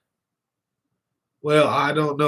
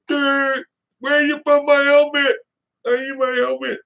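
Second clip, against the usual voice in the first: much higher goofy demanding voice; silly high voice; 14 semitones higher, 3 dB louder than usual. Retold or imitated. To his wife, demanding his helmet in a much higher goofy voice. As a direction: ham it up in a squeaky whiny voice. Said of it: "Then I started going all".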